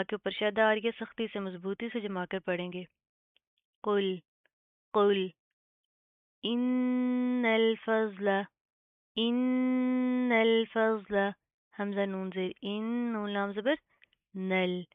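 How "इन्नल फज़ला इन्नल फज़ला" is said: इन्नल with a long nasal hum before the lam, very slow; slow chanted recitation of इन्नल फज़ला; ghunna on nun. Shown as a demonstration. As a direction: hold the doubled nun of इन्नल in the nose. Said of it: "नून के ऊपर शद है, पहले गुन्ना करेंगे".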